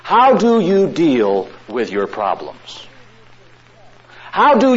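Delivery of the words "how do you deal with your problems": ordinary delivery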